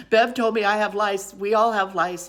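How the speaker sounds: loud, high frantic phone call; hammed-up alarm, retelling a frantic call; acted panic. Frantic phone call to Steve retold.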